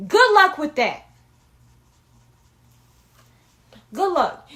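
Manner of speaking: loud sarcastic good luck; mock them with sarcasm. Real, not acted. Sarcastic dismissal of rival team.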